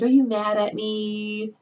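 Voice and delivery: high, drawn-out imitation of a coworker; mock needy check-in. Coworker checking whether she upset her, imitated as high, slow neediness. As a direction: stretch it out high, fishing for reassurance.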